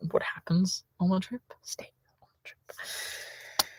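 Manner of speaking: conspiratorial whisper, slowing right down. Real, not acted. To viewers about oversharing, a slow secretive whisper. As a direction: hush it like a shared secret.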